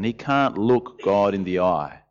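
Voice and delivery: normal talking voice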